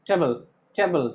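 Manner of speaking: TABLE as a short, unstressed tebl; finished quickly. Shown as a demonstration. Teacher shows the word ending, टेबल clipped to "tebl". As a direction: say टेबल fast and falling, vowels reduced. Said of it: "तुरंत बोल के finish कर देंगे".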